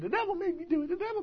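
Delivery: high comic voice mimicking a comedian; comedian's catchphrase.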